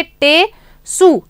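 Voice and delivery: टेसु said very slowly, each sound clear; slow model word. Shown as a demonstration. Teacher to pupils, modelling टेसु very slowly. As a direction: spell out टेसु slowly for the class. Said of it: "टेसु को कैसे पढ़ेंगे".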